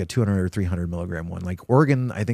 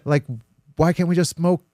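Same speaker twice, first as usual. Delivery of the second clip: higher, fed-up rhetorical rant; retold exasperated rant; 6 semitones higher, 3 dB louder than usual. Ranting to his friend about dabs, higher fed-up disbelief. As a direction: throw up your hands at the whole science experiment, pitched up.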